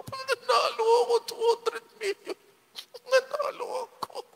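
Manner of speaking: very high, tight squeal held back from a shout; bottled-up joy. Pretend. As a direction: want to scream but keep it inside. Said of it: "gusto kong sumigaw".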